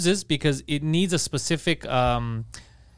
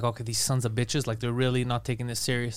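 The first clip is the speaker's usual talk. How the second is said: low, much softer seething to himself; muttered anger; 6 semitones lower, 8 dB quieter than usual. Retold or imitated. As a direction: grumble it under the breath, angry. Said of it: "So I'm getting angry".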